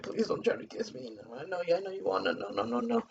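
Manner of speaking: soft, barely voiced pleading; hushed plea to a date leaning in for a kiss. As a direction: beg him quietly to back off. Pushing away the kiss in the theater.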